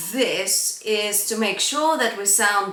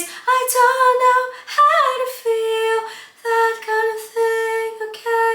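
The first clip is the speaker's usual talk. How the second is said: breathy falsetto; 14 semitones higher, 4 dB louder than usual. Shown as a demonstration. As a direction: sing it airy and light. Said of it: "I call it a breathy head voice".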